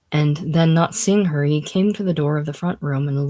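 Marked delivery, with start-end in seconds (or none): none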